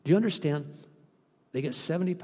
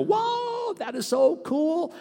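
much higher whoa, then slow amazed words; amazed churchgoer; 3 dB louder, much slower than usual. Retold or imitated. Churchgoer reacting to the pastor's word, amazed. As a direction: gasp it out like a wowed listener. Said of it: "you go".

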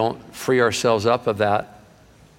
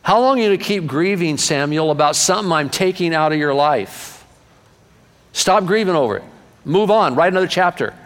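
higher and louder, urging; God's firm rebuke; 6 semitones higher, 3 dB louder than usual. Acted. Preacher repeating God's words to Samuel, louder and higher. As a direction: call out like God pushing Samuel to move on. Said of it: "Can I say it again?".

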